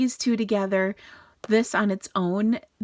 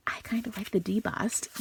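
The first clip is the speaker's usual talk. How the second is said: whispering; 2 semitones higher, 6 dB quieter than usual. Real, not acted. Confiding aside.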